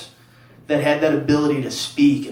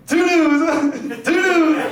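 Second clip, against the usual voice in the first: high-pitched mock child voice; punished little boy; 12 semitones higher, much slower than usual. Son upstairs in the corner repeating it, high pitiful voice. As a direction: squeak the catchphrase up high.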